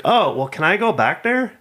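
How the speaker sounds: high, fussy older lady, hammed-up; mock lady voice. Imitating the confused lady asking about hunters, high and fussy. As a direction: lift the pitch and play her flustered. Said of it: "she's like".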